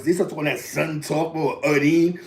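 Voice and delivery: ordinary talk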